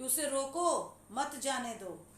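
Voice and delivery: drawn-out rising रोको, pause, then मत जाने दो; pause after रोको. Shown as a demonstration. Teacher explaining second comma example, pause after रोको. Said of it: "नीचे वाले उदाहरण में रोको के बाद कॉमा है".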